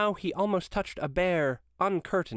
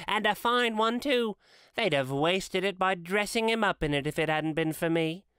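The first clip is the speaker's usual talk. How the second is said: high, cackling woman's voice; a thief dismissing guilt to Mrs Dilber; brash old woman; 7 semitones higher than usual. A narrator's reading. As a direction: toss it off like a hard old thief.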